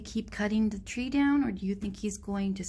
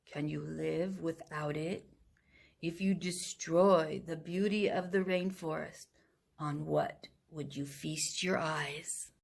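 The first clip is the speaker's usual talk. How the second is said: low, slow, unhurried sloth voice; deep lazy sloth; 3 semitones lower, slower than usual. Acted. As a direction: let each word hang lazily, deep down. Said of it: "she spoke in her deep and lazy voice".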